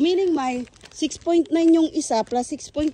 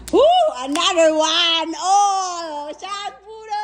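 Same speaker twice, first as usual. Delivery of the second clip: high, loud party whoop; whooping cheer; 5 semitones higher, 5 dB louder, much slower than usual. Real, not acted. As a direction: whoop and draw the words out high. Celebrating another shot. (malakas na hiyaw ng tuwa)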